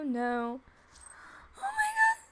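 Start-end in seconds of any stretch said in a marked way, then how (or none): 1.6-2.2 much higher, near-crying squeal of delight; gushing squeal. Real, not acted. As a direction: melt over how cute it is. Gushing at own sim.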